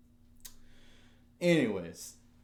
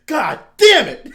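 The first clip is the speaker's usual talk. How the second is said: explosive, much higher and louder roar of rage; furious yell; 21 dB louder, slower than usual. Pretend. Imagined calm husband flipping the table, furious shout. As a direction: blow up like a man finally losing it.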